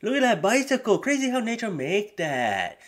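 mock naive wonder; awed dimwit. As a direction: marvel at everything like a fool. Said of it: "He's like".

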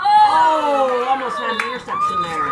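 high, loud cheering shout at the game; excited shout. Real, not acted. Grandma watching football, yelling in excitement. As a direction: yell out at the near interception.

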